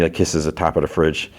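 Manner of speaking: ordinary talk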